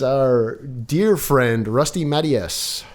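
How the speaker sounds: slow grand introduction. Acted. Host introduces the guest.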